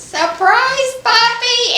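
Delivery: imitating a tiny granddaughter shouting her surprise; high, loud toddler voice yelling surprise. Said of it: "Allison says".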